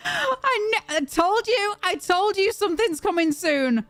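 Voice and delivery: high, excited, drawn-out exclamations; so happy for you. Real, not acted. Streamer celebrating a viewer's six months of hell ending, overjoyed. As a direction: gush with real joy, still pitched high. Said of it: "I'm so happy for you".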